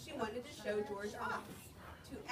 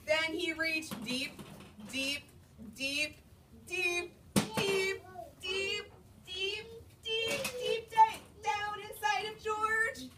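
building suspense; 7 semitones higher, slower than usual. A narrator's reading.

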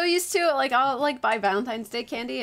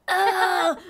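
a strained, higher mock groan; stressed ghost groan; 6 semitones higher, 4 dB louder than usual. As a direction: groan like a ghost under pressure. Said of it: "it sounded so stressed out".

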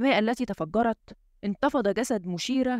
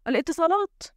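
higher-pitched cry of sudden realisation; startled exclamation; 8 semitones higher than usual. A narrator's reading. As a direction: exclaim as it dawns on you, pitch jumping up. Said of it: "وهو يهتف". (هتاف مفاجئ بنبرة أعلى)

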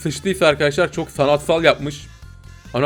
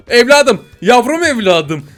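put-on loud, raised cry for a lost child; calling out; 5 semitones higher, 6 dB louder, slower than usual. Parent calling the missing child, loud mock-distressed call. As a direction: call out high and loud, drawing it out.

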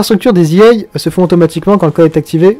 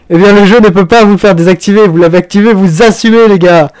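raised, higher voice telling players off; playfully stern, louder reprimand; mock scolding; 4 semitones higher, 5 dB louder than usual. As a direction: lay down the law in jest.

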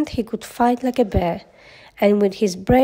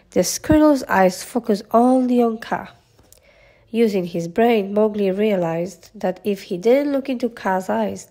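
ordinary delivery

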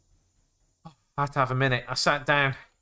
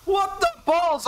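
high, drawn-out startled exclamation; loud outcry; 3 dB louder, slower than usual. Real, not acted. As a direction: cry it out high, then drop toward normal. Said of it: "Out loud. Very loud".

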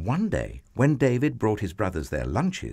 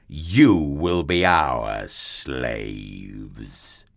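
slow booming challenge, words stretched out; shouting giant; 3 semitones lower, much slower than usual. A narrator's reading. Goliath challenges God's people to a fight, slow giant's shout. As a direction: sink lower and draw out the threat towards the end. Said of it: "Goliath came out and shouted".

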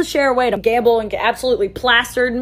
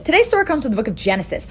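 ordinary delivery

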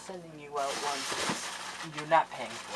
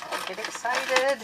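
higher, put-on excited repeat; 7 semitones higher than usual. Shown as a demonstration. Vlogger mocking herself to viewers, higher, put-on. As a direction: echo her own words in a squeaky voice.

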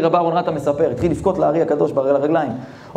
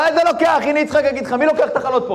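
indignant rabbi; 10 semitones higher, faster than usual. Acted. Rabbi to the gabbai.

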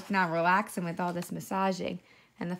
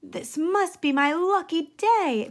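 fox shouting; 8 semitones higher, 7 dB louder than usual. Acted. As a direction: crow it out like a fox who caught his dinner. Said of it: "the fox shouted".

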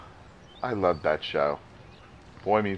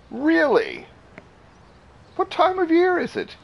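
much higher, incredulous questions. Real, not acted. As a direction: pitch way up in disbelief. Reacting with disbelief.

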